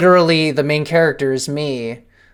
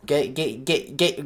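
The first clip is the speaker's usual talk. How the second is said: ordinary delivery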